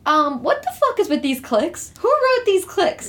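high, slow disbelieving rant; slow high-pitched disbelief; exasperated rant. Real, not acted. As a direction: drag out the outrage. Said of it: "I got a few things to say".

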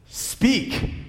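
sudden high-pitched command; lightly acted demand from a witch queen; imperious witch. A narrator's reading. Witch ordering silent animals to answer, imperious.

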